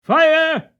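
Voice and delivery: a sudden high, loud shout of alarm; cry of fire. A narrator's reading. Someone outside raising the alarm, shouting fire. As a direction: yell it out as the stable burns. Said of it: "Then I heard a cry of".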